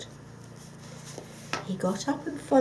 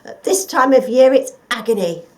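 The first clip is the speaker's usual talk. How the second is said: loud dog voice, a bit higher; gruff dog; 4 semitones higher, 15 dB louder than usual. Acted. The watchdog answering the fox, loud dog voice. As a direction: bluff watchdog talking to the fox.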